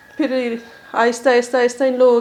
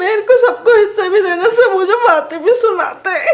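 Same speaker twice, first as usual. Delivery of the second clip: high-pitched sobbing plea; real weeping, voice climbing as it pleads; crying; 6 semitones higher, 4 dB louder than usual. Real, not acted. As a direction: beg desperately through tears. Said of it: "पहले रोना तो बंद करें ना".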